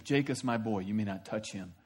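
gently but firmly forbid; God warning Laban off Jacob; soft stern God. Acted.

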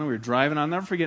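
ordinary delivery

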